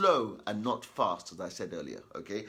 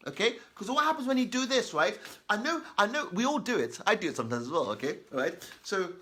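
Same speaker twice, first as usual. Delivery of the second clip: ordinary delivery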